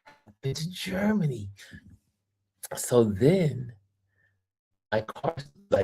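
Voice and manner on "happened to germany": high, hushed disbelief; mock bewildered question; lightly voiced stunned tourist; incredulous. Retold incredulous quote.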